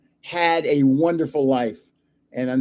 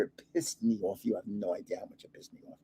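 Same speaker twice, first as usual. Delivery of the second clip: much lower and softer, muttered aside; mock grumble; 9 semitones lower, 14 dB quieter, faster than usual. Real, not acted. Telling viewers how the dog annoyed him, low muttered grumble. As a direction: grouse under the breath, quickening.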